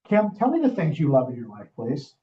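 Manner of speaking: usual speaking voice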